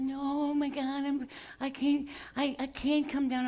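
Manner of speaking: high, hammed-up whiny cat voice; dramatic cat. The cat stuck in the tree whining at the owner, high and dramatic. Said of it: "He's like".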